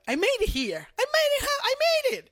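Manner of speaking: sudden high-pitched joyful protest; overjoyed disbelief. Retold or imitated. In heaven, protesting to Jesus, high joyful disbelief. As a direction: squeal it, as if you can't believe you got there.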